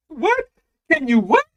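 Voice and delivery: high-pitched shocked question; stunned disbelief. Retold or imitated. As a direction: act floored by your son. Dad shocked at son.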